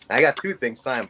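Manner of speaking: usual speaking voice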